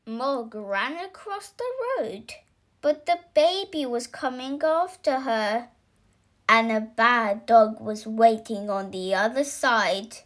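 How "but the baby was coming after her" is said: plain reading voice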